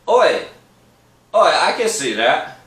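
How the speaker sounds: loud, high Cockney "Oi!" with a quick British lilt; a bad, brash British accent; attempted British accent. Mate's joking Cockney impression to a friend. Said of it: "bad at fucking accents".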